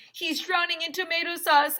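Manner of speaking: high, loud cry; alarmed shout. Acted. Emily shouting to the crowd, alarmed shout. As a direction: shout it in a panic. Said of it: "Emily shouted".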